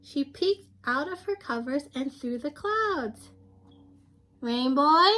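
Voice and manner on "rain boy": loud call from a window. Acted. Sun Kid calling to Rain Boy from her window, loud call. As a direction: call out across the street to a friend.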